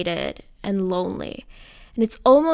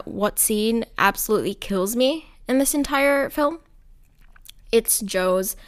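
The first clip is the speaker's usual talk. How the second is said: ordinary talk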